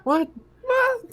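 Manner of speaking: a startled, squeaked what; squeaky what. Real, not acted. Player startled by the dice question, squeaking. Shocked reaction to push question.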